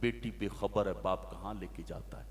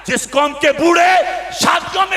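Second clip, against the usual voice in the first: high, very loud chanted preacher's cry; fiery preaching; 22 dB louder than usual. Acted. Preacher lamenting a ruined nation, at full force. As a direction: cry it out to the whole crowd.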